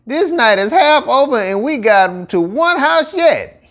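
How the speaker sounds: a hint of a fretting gator; Etienne hollering. A narrator's reading. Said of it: "that Etienne hollered".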